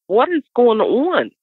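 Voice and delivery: high, slow puzzled question; confused question. Retold or imitated. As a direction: ask it baffled. Confused self in the car.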